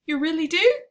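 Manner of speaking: high, loud delighted question; light right up with joy; the giant thrilled by a compliment; suddenly brightening. Acted. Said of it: "suddenly brightening".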